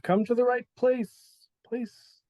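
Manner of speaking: ancient dwarven clerk echoing down the hall. Acted. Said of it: "it, like, continues to echo".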